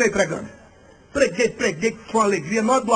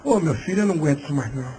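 put-on low, worn-out old man; deep, weary fatherly groan; old pastor; 10 semitones lower, faster than usual. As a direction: imitate an elderly pastor who can't take any more. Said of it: "velho, homem de Deus".

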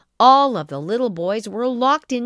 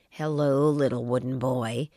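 sly fox; 8 semitones lower than usual. A narrator's reading. Sly fox greets Pinocchio.

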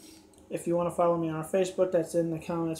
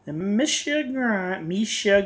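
announcer intro; 3 semitones higher, 4 dB louder, much slower than usual. Acted.

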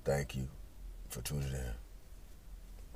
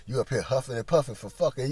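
ordinary talk, nothing marked

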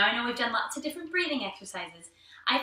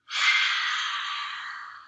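lion's breath sigh. Shown as a demonstration. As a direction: push breath out with tongue stuck out. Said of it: "as you breathe out".

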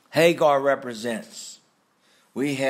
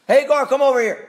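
mock calling out; 11 semitones higher, 7 dB louder, faster than usual. Voicing a call to Hagar.

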